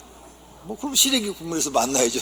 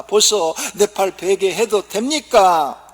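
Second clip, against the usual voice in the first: higher, slow Gyeongsang plea; Gyeongsang wife asking; 8 semitones higher, 9 dB louder, slower than usual. Acted. Gyeongsang wife asking husband for his arm, slow dialect. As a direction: ask slowly in Gyeongsang dialect. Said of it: "남편한테 말을 합니다".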